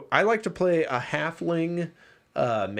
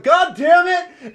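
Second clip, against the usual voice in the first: group outcry; 13 semitones higher, 10 dB louder, slower than usual. Retold or imitated. Party reacts to forest burning.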